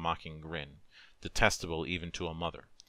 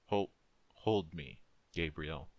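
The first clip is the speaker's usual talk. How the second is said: final breath; slower than usual. A narrator's reading. Dying wife asking her husband to hold her, slow and faint. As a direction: breathe it out as your last. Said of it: "she said with a final breath".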